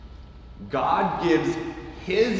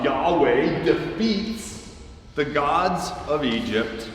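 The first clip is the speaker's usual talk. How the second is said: normal talking voice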